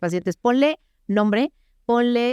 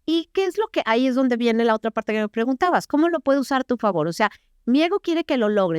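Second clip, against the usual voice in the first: ordinary talk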